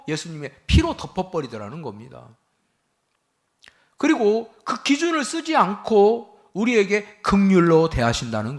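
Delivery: loud, high preaching, pressing each phrase; fiery preaching. Acted. Pastor preaching to his congregation, loud and urgent.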